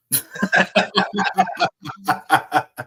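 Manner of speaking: long burst of real laughter; hearty laugh. Real, not acted. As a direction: crack up in a run of quick ha-ha-has. Amused after medication joke.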